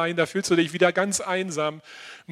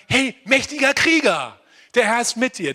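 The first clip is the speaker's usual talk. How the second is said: higher, louder shout of Hey, mächtiger Krieger; rousing call; 6 semitones higher, 5 dB louder than usual. Acted. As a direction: call him up like a coach rallying a fighter. Jesus calling out to Gideon. (laut und anfeuernd, höher gerufen)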